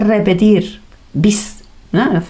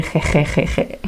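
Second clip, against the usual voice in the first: mock laugh; 5 semitones lower, 6 dB quieter than usual. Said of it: "nos tenemos que reír".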